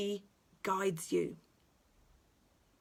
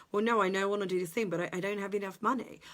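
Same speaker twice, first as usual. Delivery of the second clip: rushed excuses; much faster than usual. Retold or imitated. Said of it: "imagined excuse voice".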